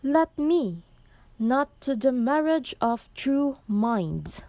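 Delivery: loud, high poetry recitation with strong stresses; emphatic verse reading. Shown as a demonstration. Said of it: "Now let's read it".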